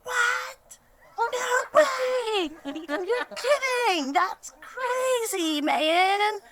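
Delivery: very high shouting astonishment, then a slow stretched question; a grown man squealing like a wowed child; amazed Gordon. Retold or imitated. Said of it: "He's like".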